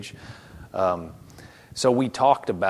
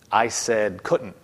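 slow, deliberate stress on "couldn't"; insistent correction; much slower than usual. Retold or imitated. Jesus correcting a doubting man, slow insistence on "couldn't". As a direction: spell out "I said couldn't" at half pace.

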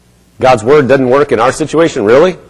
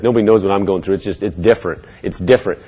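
low, sulky hard-done-by tone, much softer; whiny self-pity; 5 semitones lower, 6 dB quieter, faster than usual. Pretend. Devil's lie voiced as mopey, much softer self-pity. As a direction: mope like nobody understands. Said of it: "But he'll say it like this".

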